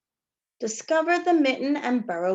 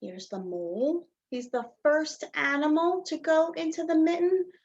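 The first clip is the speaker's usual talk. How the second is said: ordinary delivery, nothing marked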